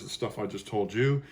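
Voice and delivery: usual speaking voice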